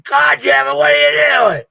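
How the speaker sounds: loud, high-pitched imitated outburst; hammed-up exasperated shouting; angry mock shout. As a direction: yell it as the fed-up husband. Retelling husband's angry outburst on camera.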